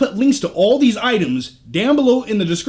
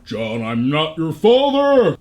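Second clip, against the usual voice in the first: lower, slow, mock Vader line; Darth Vader voice; 4 semitones lower, slower than usual. Vlogger quoting Darth Vader beside his figure, low and slow. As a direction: intone it like a masked villain.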